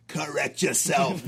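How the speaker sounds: loud, slow, stern order; barked command. Acted. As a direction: bark it like a drill sergeant. Drill-sergeant command to recruits.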